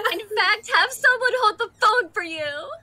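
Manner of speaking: exasperated scolding. Acted. Acted tearful quarrel over phone.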